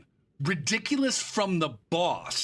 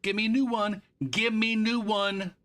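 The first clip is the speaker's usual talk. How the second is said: mock demand; 9 semitones higher, 3 dB louder than usual. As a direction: whine it out like a pushy customer. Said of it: "I'll just say".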